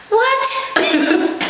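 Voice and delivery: high squeaky disbelief; incredulous what. Real, not acted. Vlogger stunned by her own realization, high squeak. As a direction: squeal it in mock outrage.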